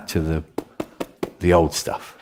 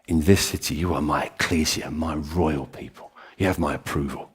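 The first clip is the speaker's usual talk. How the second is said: solemn voice of Jesus; 6 semitones lower, 4 dB quieter than usual. Retold or imitated. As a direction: speak with calm divine authority. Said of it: "he says".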